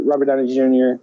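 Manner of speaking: ordinary talk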